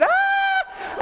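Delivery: very high, sustained shriek; piercing falsetto mock scream. Skydiver showing his photo, acting out the scream. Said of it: "I'm screaming at".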